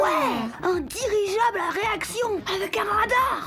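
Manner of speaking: high, excited wishing aloud. Acted. Child excitedly dreaming up a flying machine.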